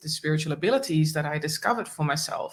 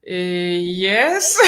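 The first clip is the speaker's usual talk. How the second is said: baffled reply; 4 dB louder than usual. Retold or imitated. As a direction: answer in mock bewilderment, pitch leaping up. Baffled answer to talking dog, retold.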